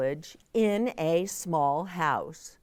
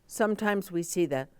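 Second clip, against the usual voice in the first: ordinary delivery, nothing marked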